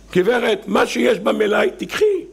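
high, ending in a shout; exasperated clerk. Acted. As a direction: lose patience like a shopkeeper. Eli snapping back comically. (מוכר חסר סבלנות בקול גבוה וצועק)

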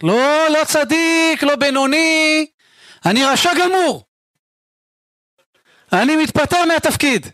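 high, drawn-out denials in a row; emphatic disavowal. Acted. Man resigning as a righteous one, emphatic high disavowal. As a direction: protest it dramatically, stretching each denial.

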